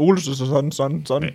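stuttered repeats of the last syllable; mock stammering. Friend stretches out dog name Olsen, mock stammer. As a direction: trip over the name's ending, repeating it. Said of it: "Godt, hvis man stammer".

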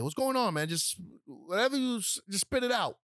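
high, insistent questioning; pressing his brother. Retold or imitated. Kevin to his nervous brother, demanding he spit it out, pressing.

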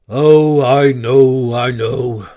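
heavy gloomy sigh of words. A narrator's reading. Badger answering Rat, gloomy. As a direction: sound weighed down. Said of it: "said the badger gloomily".

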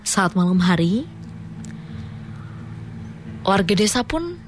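slow, spooky story reading; story narration. Acted. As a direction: read it like a ghost tale. Reads listener's horror story.

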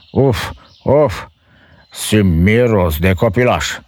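high, loud ogre sniffing; roaring ogre. A narrator's reading. Ogre smelling a child on returning home. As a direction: growl like a hungry ogre. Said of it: "răcni el cu glas înspăimântător".

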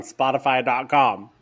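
Spotify dot com in an odd accent. Real, not acted. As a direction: slip into a strange accent, loudly. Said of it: "What was that accent?".

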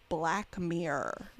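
put-on drawn-out generic American accent. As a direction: say it slowly in a plain American accent for voice control. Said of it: "generically American accent".

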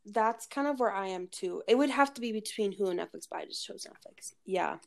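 ordinary talk, nothing marked

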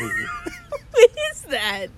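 high, drawn-out laughing shriek; laughing squeal of disbelief. Real, not acted. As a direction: stretch it out mid-laugh, way up high. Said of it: "You are so loud".

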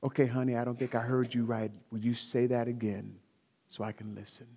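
low, gentle, softening request; mild, measured voice of a listening partner; acted patient spouse. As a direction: ask calmly and low, trailing off softly.

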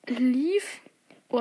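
usual speaking voice

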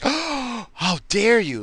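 high, drawn-out scandalised gasp of a line; mock outrage. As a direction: mimic someone utterly scandalised, high and slow. Mimics scandalised reaction of those days.

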